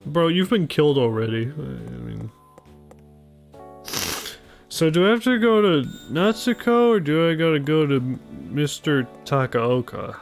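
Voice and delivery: ordinary delivery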